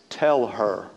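a hint of a fed-up hostess; Martha's low demand. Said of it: "you can hear her voice".

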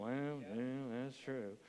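imitating a reluctant, hushed agreement; grudging admission. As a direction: admit it very softly, as if hating to. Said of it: "You hate to admit it".

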